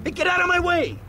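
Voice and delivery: high, loud cry, flailing about; excited yell. Real, not acted. Lawyer barging around the room, yelling high and excited. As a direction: shout it as you barge past.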